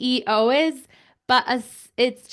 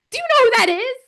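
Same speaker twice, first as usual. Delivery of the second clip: starstruck excitement; 13 semitones higher, 6 dB louder than usual. Retold or imitated. Retelling starstruck question to bystanders.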